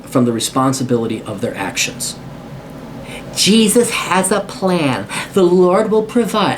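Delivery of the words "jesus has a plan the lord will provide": mock pious woman.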